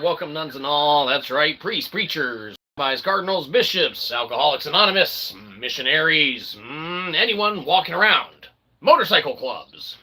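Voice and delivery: normal talking voice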